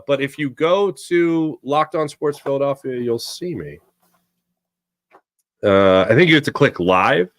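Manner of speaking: ordinary talk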